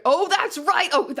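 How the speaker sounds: high, loud burst of remembering; oh, it all comes back at once; loud realization; sudden realization. Real, not acted. Sudden recollection while reading.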